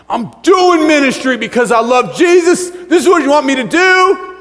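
very high, loud shout; frantic protest. Acted. Preacher acting out his plea to Jesus, shouting in frantic protest. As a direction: yell it as a desperate self-justification.

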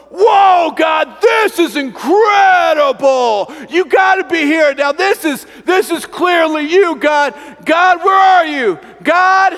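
loud, high, drawn-out cries to heaven; booming, high-pitched calling out; excited shouting. Retold or imitated. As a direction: shout up at God in amazement, then searching. Elijah shouting to God in fire.

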